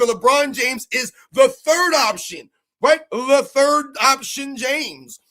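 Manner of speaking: slow, heavy stress on third option; drawn-out emphasis. Real, not acted. Streamer hammering the third option point to chat.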